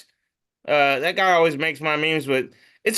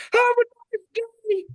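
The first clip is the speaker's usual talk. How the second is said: cartoon voice. Cartoon butter knife voiced.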